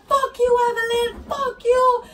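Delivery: high mock wail of abuse; imitated yelling. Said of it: "this bitch fucking starts yelling at me".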